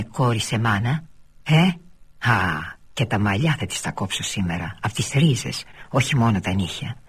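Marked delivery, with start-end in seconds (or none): none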